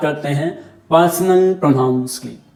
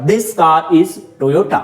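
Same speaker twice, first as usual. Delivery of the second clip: slow example; much slower than usual. Shown as a demonstration. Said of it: "demonstrative adjective का काम कर रहा".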